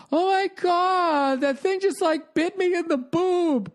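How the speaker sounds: high, slow whiny mock voice; hammed-up high whine about the bite; mocking whiny woman. Mocking the bitten tourist with a high, slow whine. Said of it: "she's doing that whole".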